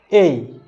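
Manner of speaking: "এই" as a long "ei" glide; correct letter A. Shown as a demonstration. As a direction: say "এই" like the English letter A. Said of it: "আসলে এটা হচ্ছে". ("এই" উচ্চারণ, লম্বা করে)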